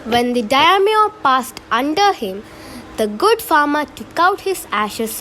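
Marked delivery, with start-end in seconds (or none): none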